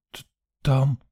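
faint, stuttered answer; shocked stammer. A narrator's reading. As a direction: stumble on the first sound.

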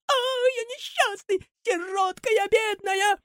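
very high, slow, piercing wail; shrill cry. Acted. Buratino wailing shrilly at Karabas. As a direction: wail it piercingly as a poor orphan. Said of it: "Пронзительно кричит".

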